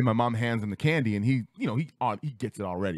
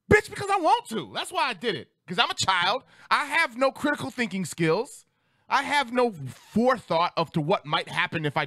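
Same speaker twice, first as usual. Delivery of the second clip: high-pitched exasperated comeback; indignant child; 10 semitones higher than usual. Acted. Child explaining himself to parents, indignant. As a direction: fire back at the parent, pitched way up.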